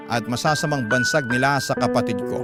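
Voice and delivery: ordinary talk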